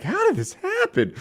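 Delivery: put-on kids baffled. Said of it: "we're like".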